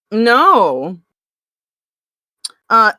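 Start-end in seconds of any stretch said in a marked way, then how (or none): none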